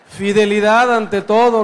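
ordinary talk, nothing marked

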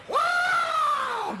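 piercing high yell of pain; high squall. Retold or imitated. John attacked in the treetop, squalling. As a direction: scream as the wildcat strikes. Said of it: "You could hear John squall".

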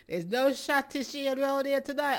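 high-pitched, amused talk through laughter; laughing. Real, not acted. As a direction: say it while still laughing. Host laughing at listener's mistaken request.

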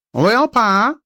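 high, chirpy bird voice for a sly magpie; cunning magpie. Acted. Magpie greeting his master in a high bird voice. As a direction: coax it out like a crafty talking bird. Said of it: "said the cunning bird".

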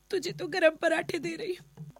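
halting, breaking into tears; tearful. Acted. Wife confiding in maid after husband left hungry, tearful. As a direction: struggle through it in short tearful bursts. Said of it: "रो मत".

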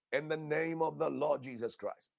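usual speaking voice

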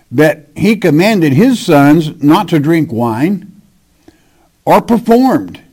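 measured, solemn Bible reading; scripture reading. Acted. Preacher reads Jeremiah to his congregation, solemn scripture reading. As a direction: read the verse out to the congregation.

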